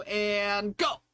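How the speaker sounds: cue to start. Real, not acted. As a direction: kick it off like a host. Host cues the start.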